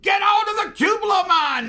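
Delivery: high, loud, urgent yell; panicked engineer yelling into the handset; retold shout. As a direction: scream the order to jump.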